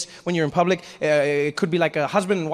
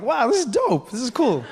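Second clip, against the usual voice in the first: higher-pitched, impressed exclamation; delighted awe; 6 semitones higher than usual. Retold or imitated. Spectator admiring python act, delighted. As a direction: marvel at the trick. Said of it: "And I was like".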